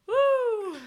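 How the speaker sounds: high loud cheer; cheering woo. Real, not acted. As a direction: whoop like at a party.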